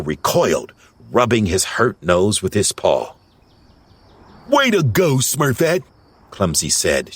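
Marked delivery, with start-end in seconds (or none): 4.5-5.8 high-pitched, pleased cheer. A narrator's reading. Clumsy shares Smurfette's victory, cheering. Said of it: "sharing the victory".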